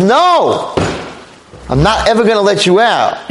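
high acted refusal, easing down toward the end; emphatic refusal. Girl to the man she has locked in, emphatic refusal. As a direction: refuse him outright.

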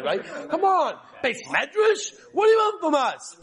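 high, loud exasperated whine; mock whine, pitched high and loud; whining excuse-maker. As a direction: act out a student full of excuses.

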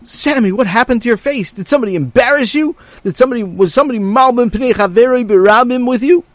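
high, loud startled father; shocked shout. Acted. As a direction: gasp it out in alarm. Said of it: "and said".

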